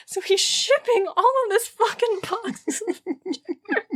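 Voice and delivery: high, laughing, somewhat slow; laughing disbelief. Real, not acted. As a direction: laugh through the words, pitched high. Amused by the dirt boxes.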